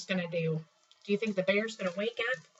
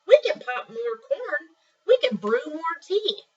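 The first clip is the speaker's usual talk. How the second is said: high, louder little mouse voice; squeaky mouse; 8 semitones higher, 6 dB louder, slower than usual. Acted. Reading to kids, Mouse comforting the upset bear squeakily. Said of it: "Mouse squeaks".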